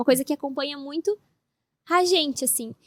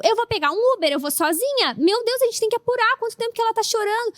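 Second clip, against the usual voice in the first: panicked; 9 semitones higher, 4 dB louder than usual. Retold or imitated. Retelling panic over crying baby.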